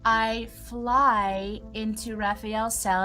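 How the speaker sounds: normal talking voice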